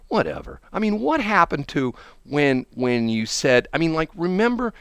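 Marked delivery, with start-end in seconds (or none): none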